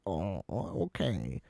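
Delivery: soft, deflated little oh, okay; meek small okay. Retold or imitated. Patient answering the doctor, meek and deflated. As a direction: shrink back, taken aback and quiet.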